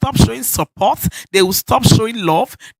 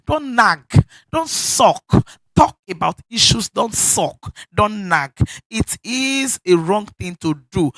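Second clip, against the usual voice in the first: ordinary talk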